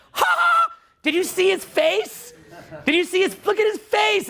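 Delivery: high-pitched, drawn-out mock hysterics; imitating uncontrollable laughter. Coach imitating entrepreneurs laughing at him, hammed-up hilarity. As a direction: act out a group cracking up. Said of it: "group would be laughing uncontrollably".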